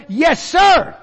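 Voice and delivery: loud, high, ringing yes sir; model answer. Shown as a demonstration. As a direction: call out the answer with full conviction. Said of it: "Are you willing to try".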